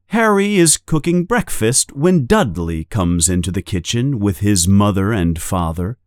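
ordinary delivery

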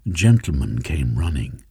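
plain reading voice

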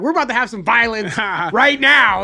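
loud, high mock threat; comically heated shout, building; angry threat. Threatening the roommate in a retold spat, angry threat.

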